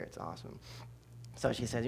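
ordinary delivery

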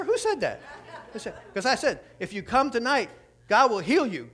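high, loud astonishment at yourself; retold disbelief. Preacher stunned by his own promise to Eva, mock disbelief. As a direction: replay your own words, comically aghast. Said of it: "I couldn't believe I said it".

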